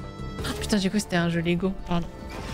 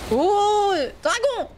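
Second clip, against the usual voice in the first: excited squeal; 13 semitones higher, much slower than usual. Real, not acted. Excited at seeing a dragon.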